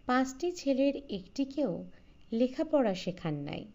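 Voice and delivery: plain reading voice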